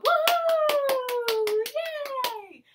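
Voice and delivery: high drawn-out woo and yay; celebratory preschool cheer. Real, not acted. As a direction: cheer for the little ones in a long, high whoop. Teacher cheers the children on.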